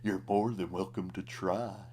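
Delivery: clown lightly mimicking a cowboy; cowboy imitation. A narrator's reading. Clown mimics cowboy's threat, lightly voiced. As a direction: echo the cowboy's line in his voice. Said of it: "In a near-perfect cowboy imitation".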